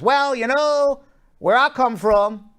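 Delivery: put-on a congressman in a playful Southern accent. Congressman bargaining in a hammed-up Southern accent. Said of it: "using my Southern accent".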